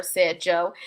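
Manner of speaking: ordinary delivery, nothing marked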